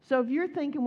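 ordinary delivery

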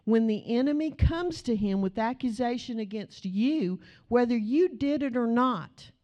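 ordinary talk

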